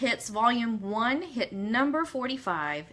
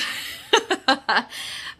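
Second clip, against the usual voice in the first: breathy self-amused laugh; 2 semitones higher than usual. Real, not acted. Joke about being a princess.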